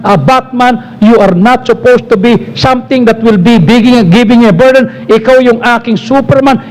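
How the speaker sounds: low-pitched voice, talking to the belly; parent addressing unborn child. Retold or imitated. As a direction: speak a blessing over the unborn baby, pitched well down. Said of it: "Kanya sabi ko sa inyo, nasa tiyan pa lang si El".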